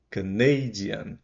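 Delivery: "Canadian" slowly, with NEI stressed; slow model, stress on NEI. Shown as a demonstration. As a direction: stretch "Canadian" and lean on NEI. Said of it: "é o nei que fica forte".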